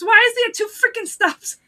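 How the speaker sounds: high, loud mock outburst; exasperated retold complaint. Retold exasperated wife driving.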